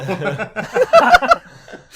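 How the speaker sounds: loud high real laugh, cracking up; big real laugh at a silly plot point; burst of laughter. Real, not acted.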